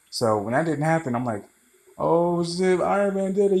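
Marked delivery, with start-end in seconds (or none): 2.0-3.6 surprised exclaim. Retold or imitated. Shocked realization watching the movie.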